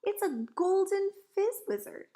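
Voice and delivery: high, loud exclamation; excited cry. A narrator's reading. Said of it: "he cried".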